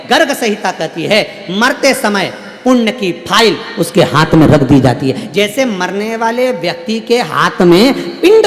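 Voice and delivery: full-force preaching. Acted. Preacher urges the congregation toward good deeds, fervent and loud. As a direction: preach it out to the whole hall.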